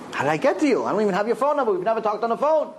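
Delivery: lightly acted bewilderment, voice lifted; baffled questioning. Stranger on the phone puzzled at the call, baffled questioning.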